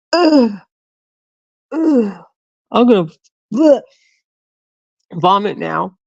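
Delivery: high mock retching; hammed-up queasy heaving; imitating gagging. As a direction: act out nearly throwing up. Coworker gagging on the nectarine.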